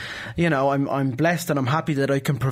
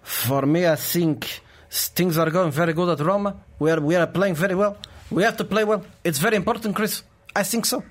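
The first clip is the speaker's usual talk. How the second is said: put-on lightly done Portuguese-accented Mourinho; Jose Mourinho voice. Host calls for Mourinho, impressionist gives a light Portuguese accent. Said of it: "Jose Mourinho".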